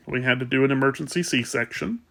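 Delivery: usual speaking voice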